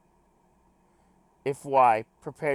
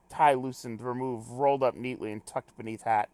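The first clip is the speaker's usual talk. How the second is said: ordinary talk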